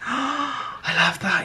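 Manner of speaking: a sharp gasp, then slow, breathy delight. Real, not acted. Vlogger marvelling at her finished crayon art, slow awed delight. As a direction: drink in the sight slowly.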